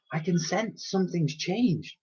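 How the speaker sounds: whispered. Acted. Elsie whispers that something has changed. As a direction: breathe it in awe. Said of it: "whispered the girl".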